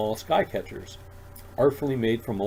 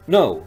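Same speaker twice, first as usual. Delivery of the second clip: a loud, firm No; emphatic no; 4 semitones higher, 12 dB louder than usual. A narrator's reading. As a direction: cut him off flatly. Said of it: "Roger says again emphatically".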